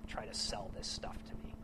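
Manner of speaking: whispered skeptical aside; whispered doubt. Retold or imitated. A doubter in church muttering about the preacher, whispered. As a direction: hush it like a suspicion. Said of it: "and you think".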